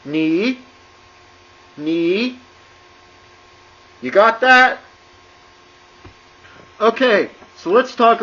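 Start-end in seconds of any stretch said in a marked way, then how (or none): 0.1-2.4 "ni" with a fall then a rise; dipping tone ni. Shown as a demonstration. Teacher recapping, dipping-rising "ni". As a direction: let "ni" dip and come up. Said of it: "you would say".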